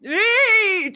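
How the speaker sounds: loud high bark; furious shout. A narrator's reading. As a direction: snap the order out. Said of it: "she shouted".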